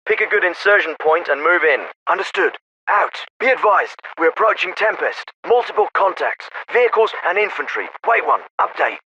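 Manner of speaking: military officer over a field radio; crisp orders through a narrow radio channel; radio transmission. Acted.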